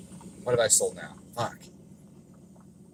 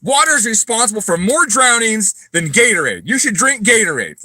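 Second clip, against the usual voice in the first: much higher, louder goofy idiot voice; mocking a dumb troll; 12 semitones higher, 9 dB louder than usual. Mocking trolls who say to drink Gatorade. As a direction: play the dumbest commenter alive. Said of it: "guys that are like".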